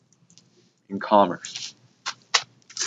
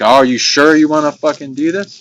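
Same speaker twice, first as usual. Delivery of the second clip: higher, louder mock second key-holder; mock nervous partner; 7 semitones higher, 8 dB louder than usual. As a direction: act out the jittery partner double-checking before the launch. Imitating partner at bomb keys.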